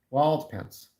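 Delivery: normal talking voice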